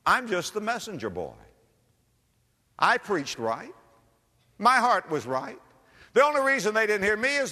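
high, lifted voice of sudden relief, retold; elated realization. Preacher retelling his answer to the Lord, elated. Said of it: "That was such a blessing, almost shouted".

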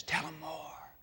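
tempting devil, breathless whisper; sly devil whispering encouragement; devil's whisper. Acted. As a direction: hiss it softly like a tempter at the ear. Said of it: "The devil says".

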